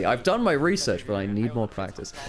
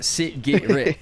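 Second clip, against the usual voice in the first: South African accent; 3 semitones higher, 4 dB louder, much slower than usual. Shown as a demonstration. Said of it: "the word which gets me into a South African accent".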